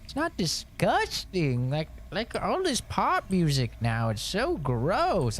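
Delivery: high prim mother voice, approving; mimicking mom. Mom praising son's music in a high prim voice. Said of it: "so she was like".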